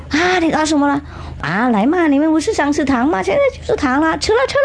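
high, bright urging to eat; giddy invitation to share sweets; eager coaxing. Acted. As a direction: push them to dig in, voice climbing. Urging kids to eat candy.

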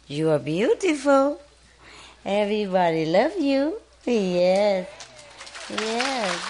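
high, sing-song praise for a shy parrot; cooing pet talk. Real, not acted.

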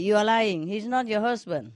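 God scolding. Acted.

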